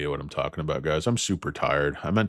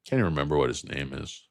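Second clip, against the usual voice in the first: usual speaking voice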